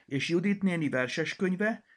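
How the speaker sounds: shouted question. A narrator's reading. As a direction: Peti calls out spotting the book. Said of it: "kiáltotta Peti". (magasabb, hangosabb kiáltás)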